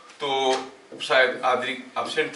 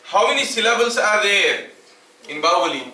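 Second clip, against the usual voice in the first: slow question; 5 semitones higher, 4 dB louder, slower than usual. Shown as a demonstration. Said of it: "Number of syllables".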